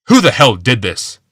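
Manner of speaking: loud, furious demand; angry growl. A narrator's reading. Old man furious at being tucked in, growling. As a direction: snarl it out in rage. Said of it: "Glaceon growled".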